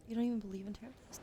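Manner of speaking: ordinary delivery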